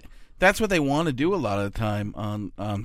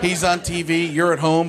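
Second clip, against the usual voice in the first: normal talking voice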